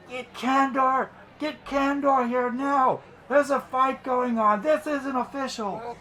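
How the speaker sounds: very high little creature voices; flying creatures yelling. Acted. Little flying creatures raising the alarm over a fight, high-pitched yelling. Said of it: "they're yelling out".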